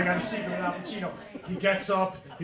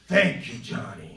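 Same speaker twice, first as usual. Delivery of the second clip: low, gruff Pacino mumble; a hint of Al Pacino; Pacino impression; 10 semitones lower, 3 dB louder than usual. Pacino thanking the waiter, in a low gruff mumble. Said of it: "He goes".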